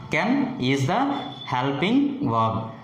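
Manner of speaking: ordinary talk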